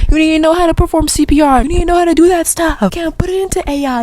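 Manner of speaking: high-pitched, worked-up scolding; exasperated rant. Real, not acted. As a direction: plead urgently, voice pushed way up. Exasperated rant at cheating students.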